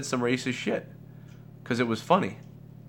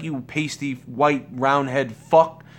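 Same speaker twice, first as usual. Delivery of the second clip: mock insult; 2 semitones higher, slower than usual. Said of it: "So if he's like".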